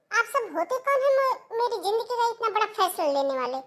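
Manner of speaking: indignant outburst. Acted. Seema confronting relatives deciding her life, indignant.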